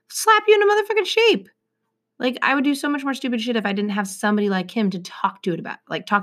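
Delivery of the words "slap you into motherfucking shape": emphatic. Real, not acted. Friend sets you straight.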